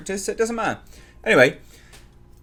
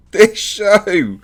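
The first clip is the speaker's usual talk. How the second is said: louder, higher laugh through the words; laughing in disbelief; 8 semitones higher, 8 dB louder than usual. Real, not acted. Reviewer laughing at the bat scene, real disbelieving laugh. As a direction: crack up at the absurdity.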